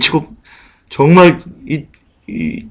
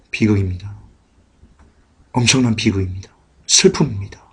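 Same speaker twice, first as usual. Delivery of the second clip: low, hushed sorrow; soft, heavy pulpit lament; grave lament; 7 semitones lower, 8 dB quieter than usual. Real, not acted. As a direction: drop the voice and mourn it quietly. Preacher laments neglect of Bible.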